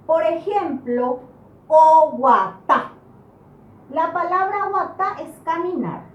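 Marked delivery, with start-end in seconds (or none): 1.7-2.9 oguatá slow and loud, stress on the last syllable "tá"; acento final. Shown as a demonstration. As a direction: draw out oguatá syllable by syllable, landing on "tá". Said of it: "en la última sílaba". (oguatá lento y fuerte, acentuando la "tá" final)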